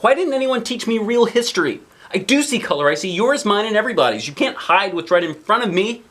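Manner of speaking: put-on the kid pours out her frustration; upset little girl. A narrator's reading. Girl protests to her mom about hidden history, high upset child voice. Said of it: "says the little girl".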